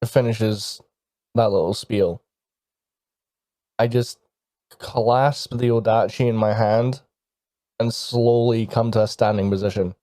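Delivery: usual speaking voice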